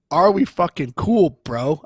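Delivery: mock menacing question. Big soldier pulls him close, slow loud menace. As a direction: press him like a threat.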